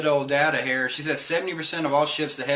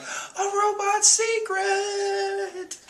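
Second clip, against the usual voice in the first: high, slow gushing wonder; starry-eyed excitement; much slower than usual. Retold or imitated. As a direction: gush it like excited kids. Crew gets starry-eyed.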